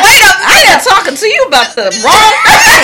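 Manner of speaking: high laughing outburst; laughing shout. Real, not acted. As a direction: crack up mid-sentence. Host laughs at her own mistake.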